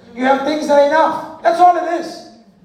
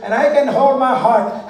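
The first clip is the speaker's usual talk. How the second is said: usual speaking voice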